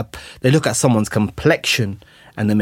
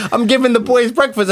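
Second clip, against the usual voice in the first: laughing through the quote; 13 semitones higher, 4 dB louder than usual. Retold or imitated. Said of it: "he's like".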